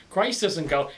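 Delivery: ordinary talk, nothing marked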